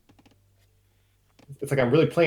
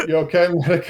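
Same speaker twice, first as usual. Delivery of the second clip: mock Kermit voice, a bit higher; imitating Kermit; 8 semitones higher, 4 dB louder than usual. Narrator repeating the Kermit line, mock high frog voice. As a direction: echo the frog line playfully. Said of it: "Clip this, Harry".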